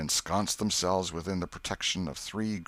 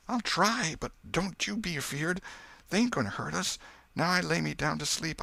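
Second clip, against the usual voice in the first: scared prayer; 12 semitones higher than usual. Acted. Scared boy starts to pray.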